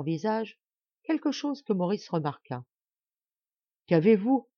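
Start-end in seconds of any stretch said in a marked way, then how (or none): 3.9-4.5 raised, louder surprised voice; surprised question. A narrator's reading. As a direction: ask it taken aback. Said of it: "demanda-t-il tout surpris". (question posée tout surpris)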